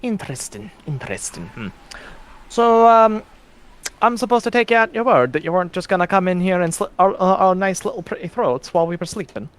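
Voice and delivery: wary, probing woman's voice; sly and distrustful; suspicious woman. Acted.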